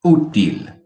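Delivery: Útil with a strong loud Ú; stress on first syllable. Shown as a demonstration. Teacher contrasting two words, first-syllable stress on Útil. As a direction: punch the Ú of Útil. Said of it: "I emphasize on the first word".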